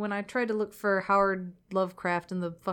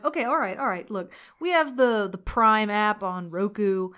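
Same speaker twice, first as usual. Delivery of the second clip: ordinary delivery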